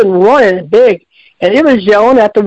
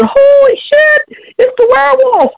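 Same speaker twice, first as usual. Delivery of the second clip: high, shrill-free panicked exclamation, much higher; panicked cry. Retold or imitated. Mother sees the werewolf by the bridge, high panicked cry. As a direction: blurt it out in sudden terror at the monster.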